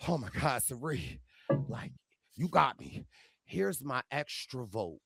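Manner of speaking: low, soft mock devotion. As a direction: imitate a hushed, awed follower handing over everything. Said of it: "[name] was like".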